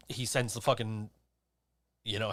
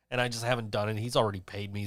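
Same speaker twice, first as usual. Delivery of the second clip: normal talking voice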